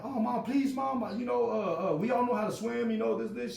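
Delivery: high-pitched retold begging; pleading kid. Kid trying to save face with mom, high pleading. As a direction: beg mom like an embarrassed boy.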